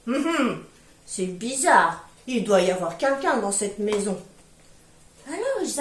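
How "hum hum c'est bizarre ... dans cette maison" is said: papa bear's big voice. Acted. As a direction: rumble it like a puzzled giant bear. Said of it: "de sa grosse voix".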